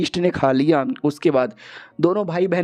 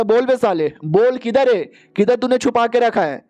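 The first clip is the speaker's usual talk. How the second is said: higher-pitched, mildly acted interrogation; angry demand; 6 semitones higher than usual. Boss interrogating a beaten captive, acted angry demand.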